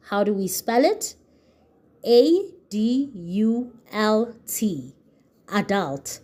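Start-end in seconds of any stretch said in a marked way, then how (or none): 2.0-4.9 letters spelled out slowly one by one; teacher spelling slowly. Shown as a demonstration. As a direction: spell each letter clearly for the class. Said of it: "I'm gonna spell the word".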